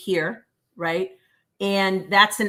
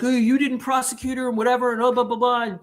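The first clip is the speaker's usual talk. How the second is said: higher, faster whiny complaining; mocking an officer; 4 semitones higher, faster than usual. Imitating an officer complaining about shoplifters, mock whiny. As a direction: mimic a griping cop, rattling on. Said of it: "So he was like".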